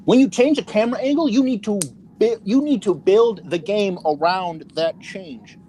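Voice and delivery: stressing each point in real exasperation; angry. Real, not acted. Gamer ranting to friends about bad game design, angry. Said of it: "You sound so angry".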